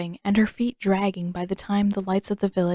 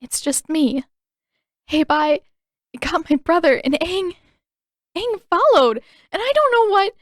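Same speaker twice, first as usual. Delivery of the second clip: high, louder, breaking through tears; tearful; 9 semitones higher, 6 dB louder than usual. A narrator's reading. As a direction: sob it out as the tears come. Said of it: "fresh stream of tears broke loose".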